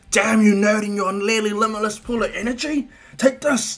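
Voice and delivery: high, loud competitive cursing; acted battle outburst; frustrated shout. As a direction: yell at the rival who just overtook you. Overtaken rival curses and attacks.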